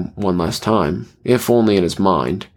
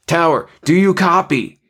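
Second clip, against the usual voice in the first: high, raised radio call; shouted; 8 semitones higher than usual. A narrator's reading. Pilot calling the tower over the radio, shouting. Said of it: "he shouted".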